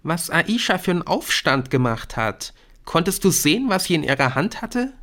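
put-on high-pitched girl's chatter; gossipy schoolgirl. A narrator's reading. Brynn chatters to Nick about Aisha, high and gossipy.

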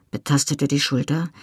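ordinary delivery

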